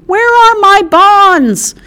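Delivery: screamed high and loud, drawn out; a piercing, slow shriek of loss; shrill scream. Acted. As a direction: heiress screaming for her stolen bonds. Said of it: "let out a shrill scream".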